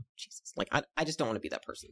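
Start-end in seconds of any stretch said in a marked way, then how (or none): 0.0-0.5 retold near-whispered exclamation; very soft, dismayed aside; muttered aside. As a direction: mutter it under your breath, barely voiced.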